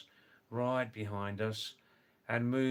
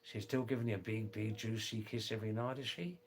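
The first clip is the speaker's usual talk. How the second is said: fast, soft, run-together Australian; broad Australian accent; 5 dB quieter, faster than usual. Shown as a demonstration. Teasing a mate about his wife, broad Australian accent. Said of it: "the other half talk like this".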